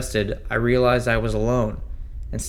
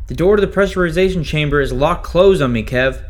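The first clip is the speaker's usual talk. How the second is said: urgent call for help; 6 semitones higher, 6 dB louder than usual. Acted. Locked in, calling control room.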